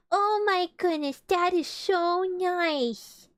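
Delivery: overwhelmed by how sweet it is; touched gush. Real, not acted. Reader moved by Eeyore.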